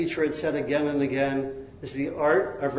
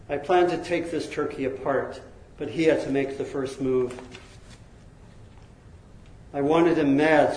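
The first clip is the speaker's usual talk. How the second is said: ordinary delivery, nothing marked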